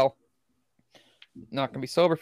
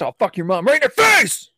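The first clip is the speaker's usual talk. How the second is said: louder, higher yelled insult; mock angry threat; 6 semitones higher, 5 dB louder than usual. Acting out a comeback for laughs, mock angry yell. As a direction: build to an over-the-top yell.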